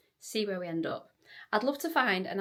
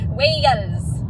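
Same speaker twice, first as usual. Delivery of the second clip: loud joyful cry; excited shout; 3 semitones higher, 13 dB louder than usual. Real, not acted. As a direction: belt out one happy word. Arriving in Wales, shouting with joy.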